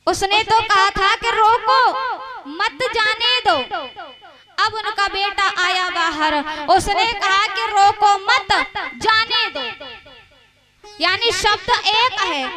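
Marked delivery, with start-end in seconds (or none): 1.3-3.6 रोको rising, a break, then मत जाने दो; pause after रोको. Retold or imitated. Storyteller explains the father's order, pause after रोको. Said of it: "उसने कहा था भाव कुछ और था". 7.7-9.6 रोको मत run together, break, then जाने दो; pause after मत. Retold or imitated. Son passes on the misheard order, pause after मत. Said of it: "इसने कहा था भाव कुछ और है".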